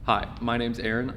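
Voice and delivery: normal talking voice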